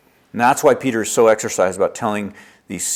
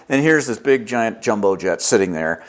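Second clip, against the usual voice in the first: ordinary talk, nothing marked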